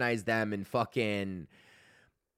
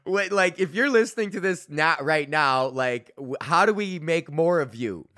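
higher, louder, eager and giggly; excited; 5 semitones higher, 5 dB louder than usual. Real, not acted. Host excitedly asking his listeners how to find more fans. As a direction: lean in, thrilled by the idea.

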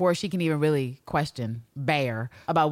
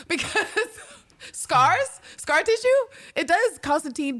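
mock excited; 12 semitones higher, 3 dB louder than usual. Mocking Constantine's line, laughing.

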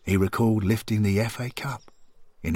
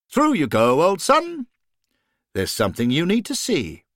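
higher, lilting voice urging a friend onward; encouraging companion; 8 semitones higher, 3 dB louder than usual. A narrator's reading. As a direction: usher him through in a raised, swooping voice. Voices Henry urging Johnny on.

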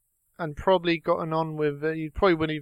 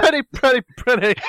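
laughing through words; 6 semitones higher, 4 dB louder than usual. Real, not acted. Repeating the joke, cracking up.

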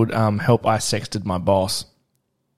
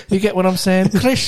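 mock tough guy; 9 semitones higher than usual. Quoting TikTok tough guy.

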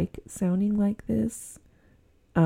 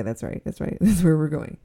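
ordinary delivery

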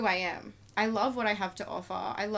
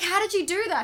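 higher and louder, astonished; amazed question; 10 semitones higher, 7 dB louder than usual. Retold or imitated. Retelling asking the therapist, higher excited amazement. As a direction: marvel at the therapist out loud. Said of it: "And I was like".

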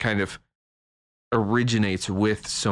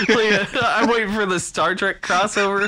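giggling; 10 semitones higher, 4 dB louder than usual. Real, not acted. Giggling joke.

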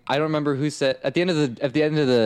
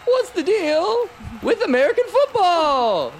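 Seinfeld impression. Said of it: "And then, and then he responds".

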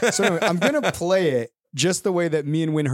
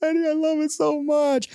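put-on high, drawn-out child's whine; pleading little girl. Dad mimicking his daughter in the merch shop, high pleading whine. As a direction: draw it out like a begging kid. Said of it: "And she's like".

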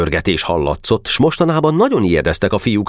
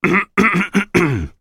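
drawn-out, portentous ahem; pointed series of throat clearings; ominous throat-clearing. A narrator's reading. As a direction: clear the throat before a big speech. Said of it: "Köszörülte a torkát vészjóslóan".

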